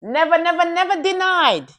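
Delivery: high, loud insistent denial; playful loud denial. Real, not acted. Friend loudly denying with a laugh. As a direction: shout the denial in mock protest.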